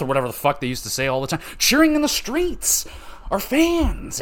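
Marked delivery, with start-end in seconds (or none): 1.6-4.2 much higher, slow hammed-up cheer; mock jubilant announcer. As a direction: crow like a giddy hype-man over a rigged trade. Mock celebration after lopsided trade.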